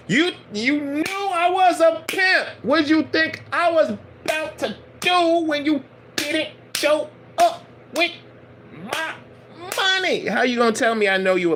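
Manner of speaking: hammed-up pimp demanding cash. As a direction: pastor mocking the master as a pimp, high and laughing. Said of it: "he like".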